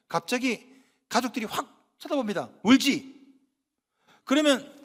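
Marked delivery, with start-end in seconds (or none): 2.6-4.7 quick, louder teasing challenge; teasing accusation. Acted. Family teasing a parent caught crying at a drama, accusing. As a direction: pounce on them with a teasing question.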